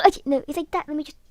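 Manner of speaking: plain reading voice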